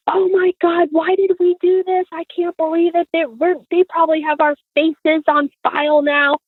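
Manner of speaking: high-pitched wail at no extra volume; mock screaming mom. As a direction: imitate an upset mom berating her husband. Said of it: "my mom was screaming at him".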